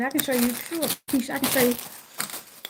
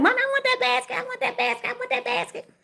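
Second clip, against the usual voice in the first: put-on whiny kid begging. Mom hears her kid begging for that basket, high whiny child voice. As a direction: beg like a little kid for the basket. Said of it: "So kids like".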